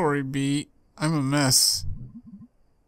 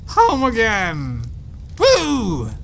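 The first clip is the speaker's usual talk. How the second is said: higher, louder, slow whooping cheer; drunken cheer; 7 semitones higher, 4 dB louder, much slower than usual. Acted. Drunk Mae cheering to her friend in the car, whooping. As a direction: yell "Home again!" like a drunk celebration.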